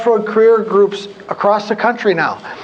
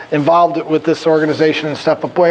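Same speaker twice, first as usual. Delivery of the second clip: ordinary talk, nothing marked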